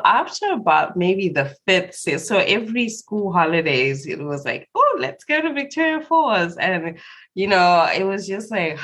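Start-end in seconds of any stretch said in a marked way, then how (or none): 4.8-6.6 much higher, bright eager pitch, mocking her younger self; eager childhood suggestion. Remembering school holidays, mock-eager much higher voice. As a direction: chirp it like a keen kid planning the holidays.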